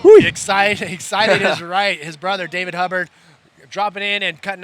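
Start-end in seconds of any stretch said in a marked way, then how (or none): 0.1-2.0 laughing, loud and slowed. Real, not acted. Anchor laughs at excited interview.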